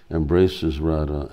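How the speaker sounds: plain reading voice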